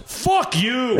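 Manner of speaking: high, punched shout into the mic; mock-furious yell; acted angry retort. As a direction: imitate an old comic blasting a heckler. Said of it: "leans out and goes".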